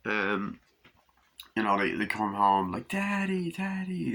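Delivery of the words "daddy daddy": put-on child's voice. Children calling their father.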